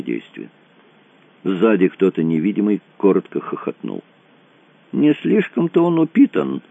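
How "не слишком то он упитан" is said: high-pitched jeering voice; mocking remark from an onlooker. A narrator's reading. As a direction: sneer it in a raised pitch. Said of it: "сказал кто-то издевательским голосом".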